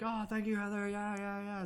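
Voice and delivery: high, bubbly thank-you to Heather; gushing thanks. Pretend. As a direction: gush with joy at Heather. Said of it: "then we'll be like".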